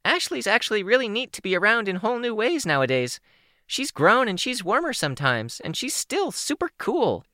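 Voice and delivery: put-on high young girl calling out, fast. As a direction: pitch it up so she's heard out of sight. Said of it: "pitched her voice higher to be heard".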